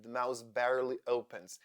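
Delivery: ordinary talk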